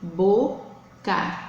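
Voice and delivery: "boca" split in two, bo... ca; syllable by syllable. Shown as a demonstration. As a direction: say "boca" one syllable at a time. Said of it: "formada por duas sílabas". ("boca" separando as sílabas)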